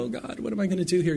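quick, high-pitched fretting; acted helpless worry, rushed; mock desperation. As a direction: imitate a soft panicky plea. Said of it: "we're in desperation".

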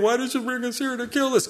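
high, nasal-free mock whine of complaining Israelites; whining. Preacher mimics Israelites complaining to Moses, high mock whine. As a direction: whine it like a spoiled crowd. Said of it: "they start whining".

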